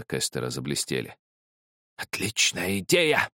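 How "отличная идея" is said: high, drawn-out mad exclamation; crazed cry. A narrator's reading. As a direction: cry out slowly, rising. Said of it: "закричал он". (безумный протяжный выкрик)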